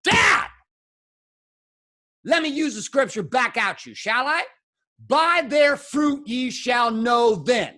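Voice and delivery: retold shouting match with father; angry retort to father. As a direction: throw the verse back at dad. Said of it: "And I was like".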